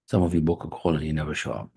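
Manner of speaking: ordinary delivery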